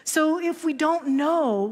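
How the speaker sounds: usual speaking voice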